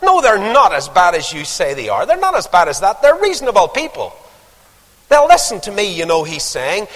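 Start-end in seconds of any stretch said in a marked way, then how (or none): 0.0-5.8 Paul bargaining with God. Retold or imitated. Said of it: "He says".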